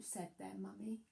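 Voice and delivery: usual speaking voice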